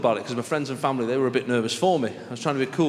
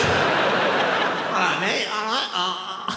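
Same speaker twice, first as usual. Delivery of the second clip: higher Mancunian lad greeting; manchester accent; 5 semitones higher, slower than usual. Retold or imitated. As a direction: swagger it, Mancunian style. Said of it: "The Mancunian walk's like".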